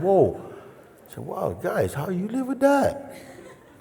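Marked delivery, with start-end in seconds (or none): 0.0-0.4 high, loud whoa of alarm; acted-out shock, pitched up; startled whoa. Retells reaction to airplane roar. 1.2-3.0 high-pitched, incredulous question to the hosts; retold reaction, voice leaping high; amazed disbelief. Retells shouting question after roar.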